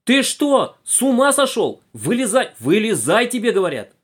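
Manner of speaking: high, loud scolding yell; frantic shout. A narrator's reading. Conductress hauls boy from under bench, yelling. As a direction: yell at him to come out. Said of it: "закричала громче прежнего".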